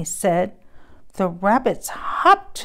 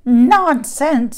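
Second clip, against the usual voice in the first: a touch of a scornful goat, louder and higher; grumpy goat; 4 semitones higher, 9 dB louder than usual. Acted. Goat scoffs at pig's snow tree, mild grumpy. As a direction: bark it out like a cranky goat.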